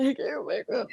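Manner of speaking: high, hammed-up sobbing words; mock tearful wail; ugly cry. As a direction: blubber it out for comedy. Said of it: "that ugly cry".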